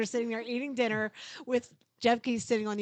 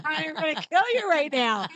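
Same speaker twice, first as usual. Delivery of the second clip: glare and threaten through laughter; playful fake rage at husband; mock furious threat; 6 semitones higher, 8 dB louder than usual. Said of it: "daggers out of my eyes".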